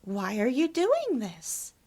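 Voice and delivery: loud imitation of a harsh demand; harsh, high-pitched. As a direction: mimic her harshly, pitch swooping up. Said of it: "in a very harsh, high-pitched voice".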